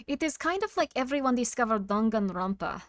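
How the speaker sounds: put-on half-German, half-Russian accent. Streamer trying out a voice for chat, mock German-Russian accent. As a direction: speak in a makeshift German-Russian mixed accent. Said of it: "I think it's a half German, half Russian".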